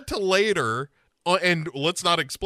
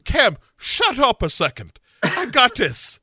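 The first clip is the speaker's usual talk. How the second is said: raised, loud, self-assured acted quote; mock take-charge Sarah; 6 semitones higher, 4 dB louder than usual. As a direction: cut in like a cocky TV detective. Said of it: "she's like".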